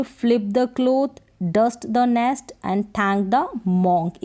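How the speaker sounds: slow, word by word; beginner read-aloud. Shown as a demonstration. Teacher reading the whole phrase for beginners, slow word by word. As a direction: read it out slowly for learners. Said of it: "इनको फिर पूरा read कर दे".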